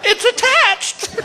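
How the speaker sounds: very high, slow squeal; drugged amazement. Retold or imitated. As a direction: squeal it slowly in doped wonder. Drugged discovery, acted.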